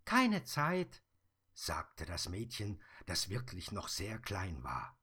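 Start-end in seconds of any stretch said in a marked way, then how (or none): 0.1-1.0 put-on high, louder child call; little girl calling. Little girl calling to the Sandman from her window, high and loud. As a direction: pipe up like a cheeky little girl.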